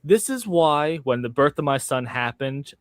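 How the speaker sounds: usual speaking voice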